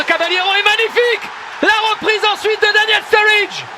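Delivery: loud, high goal call; excited sports commentary. Real, not acted. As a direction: ride the goal with rising excitement. Goal replay, commentator shouts.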